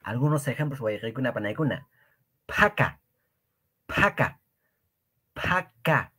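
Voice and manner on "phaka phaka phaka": phaka with a breathy burst on the ph; aspirated ph. Shown as a demonstration. Teacher drilling the aspirated p, puffed ph. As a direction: push air hard after the p in phaka. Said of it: "P H, pa".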